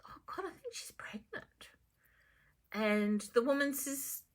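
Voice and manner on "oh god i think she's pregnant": hushed private thought; whispered thought. Acted. Phyllis thinking to herself about the woman, whispered. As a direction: breathe it to yourself.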